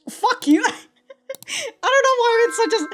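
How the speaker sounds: ordinary talk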